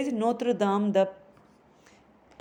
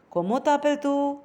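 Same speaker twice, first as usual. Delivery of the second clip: raised questioning voice on "Comment t'appelles-tu?"; higher question pitch; 5 semitones higher, faster than usual. Shown as a demonstration. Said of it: "change your voice modulation a little bit higher when you are asking".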